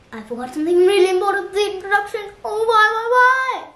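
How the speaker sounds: fast, high realisation climbing to a wail; dismayed outburst. Real, not acted. Teacher forgot something important in introduction, dismayed. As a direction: blurt it out, rising to a loud lament.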